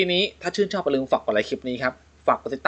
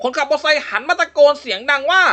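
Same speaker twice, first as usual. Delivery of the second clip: raised storytelling voice, spooky build; ghost story narration; 8 semitones higher than usual. A narrator's reading. Host reads a ghost joke to co-host, storytelling voice. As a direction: tell it like a campfire ghost tale.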